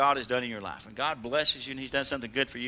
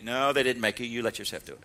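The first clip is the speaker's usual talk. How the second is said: ordinary delivery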